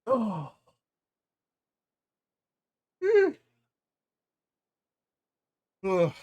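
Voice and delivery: spluttering after a drink went down the wrong pipe; choking and clearing the throat. Real, not acted. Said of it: "Something went down the wrong pipe".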